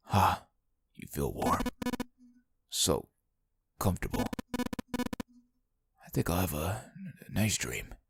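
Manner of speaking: very soft, low, fading; fading drowsy voice. Acted. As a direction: slip into sleep. Ace fading to sleep.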